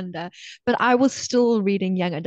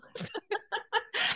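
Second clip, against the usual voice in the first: high, squealing giggle; excited squeal; 12 semitones higher, 9 dB quieter than usual. Real, not acted. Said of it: "get way too excited and squeal".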